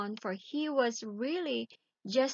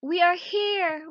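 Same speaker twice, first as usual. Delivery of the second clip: loud, high, slow shout; children at the door shouting to their mother; 7 semitones higher, 8 dB louder, slower than usual. Acted. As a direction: call out so she hears you through the door. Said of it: "started to shout all together".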